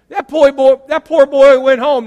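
outraged preacher's plea; indignant preaching. Real, not acted.

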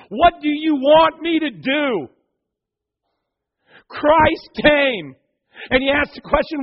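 rising to a loud sermon climax; full-force preaching. Real, not acted. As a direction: preach it to the back row.

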